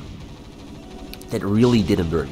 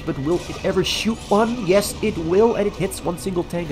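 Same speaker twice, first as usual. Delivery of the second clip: high, hyped up call; excited esports caster; 8 semitones higher than usual. Real, not acted. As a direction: build the tension then pay off. Calls the scarab shot live.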